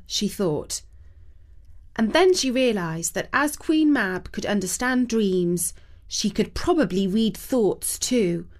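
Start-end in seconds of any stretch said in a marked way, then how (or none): none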